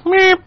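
squeaky high beep sound; imitated beep. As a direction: mimic the line-change beep. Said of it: "He goes".

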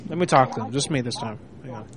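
chewing tobacco. Real, not acted. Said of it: "Got tobacco in my mouth".

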